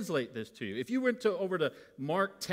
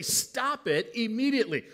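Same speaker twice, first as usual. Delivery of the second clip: firm command; 8 semitones higher, 5 dB louder than usual. Retold or imitated. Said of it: "It's literally".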